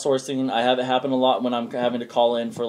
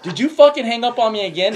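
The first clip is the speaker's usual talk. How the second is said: higher, louder, half-laughing outrage; a laughing fake fury at the telemarketer; mock angry caller; 9 semitones higher, 5 dB louder than usual. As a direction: play the caller getting pissed. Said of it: "he's starting to get pissed".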